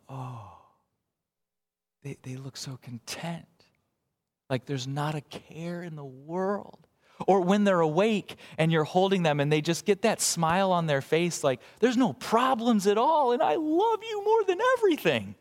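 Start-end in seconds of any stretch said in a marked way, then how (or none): 0.1-6.9 put-on soft, slow hushed coo over a baby; tender cooing. Parent gazing at a content sleeping infant, softly cooing. 11.8-15.3 put-on high, sweet baby voice climbing even higher; baby's voice. Pastor voicing the smiling infant in his arms, high and sweet.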